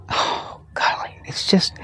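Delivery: whispered rapture; breathless whisper. Real, not acted. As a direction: let it fall away to a whispered gasp. Overcome by the tomato's taste.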